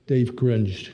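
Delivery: ordinary delivery, nothing marked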